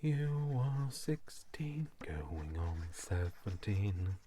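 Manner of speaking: breathy whispered singing, slow; whispered song line. Pretend. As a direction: whisper the lyric slowly, like a secret.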